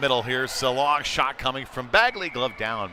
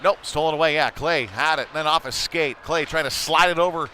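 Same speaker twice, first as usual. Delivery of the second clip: higher and louder, rapid-fire play-by-play; excited call; 5 semitones higher, 5 dB louder than usual. Real, not acted. Announcer calling a goalmouth scramble for listeners, higher and louder. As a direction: keep the energy up through the scramble.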